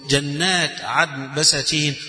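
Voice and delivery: ordinary talk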